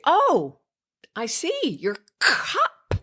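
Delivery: high, bright model voice hitting cup; stressed, modelling cup. Shown as a demonstration. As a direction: sing out your cup for the toddler. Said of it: "And you stress it".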